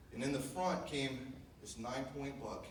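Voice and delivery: ordinary talk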